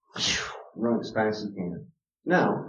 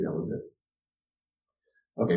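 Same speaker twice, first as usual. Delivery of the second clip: ordinary delivery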